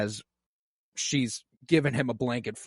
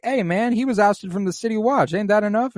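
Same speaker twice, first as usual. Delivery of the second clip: high, laid-back dude impression of a king; lightly voiced king talking like a dude; casual bro voice; 8 semitones higher, 3 dB louder than usual. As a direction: play the king like a chill bro. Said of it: "Viserys is like".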